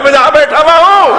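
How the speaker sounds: high, loud punchline cry of the holy fool; triumphant shout. Acted. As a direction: fling the last line out in triumph. Bahlol's triumphant retort in story.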